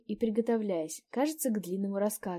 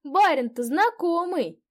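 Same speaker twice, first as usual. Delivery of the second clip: put-on higher, louder delighted old peasant woman; old landlady; 9 semitones higher, 11 dB louder, slower than usual. A narrator's reading. Old landlady greets a guest she knows, delighted. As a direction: gush over a familiar face.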